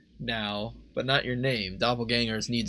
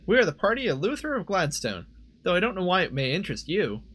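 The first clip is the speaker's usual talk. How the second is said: high, lilting heroine voice; higher, formal character voice; higher pitched character; 7 semitones higher than usual. Acted. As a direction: pitch it up as the adventurer answering.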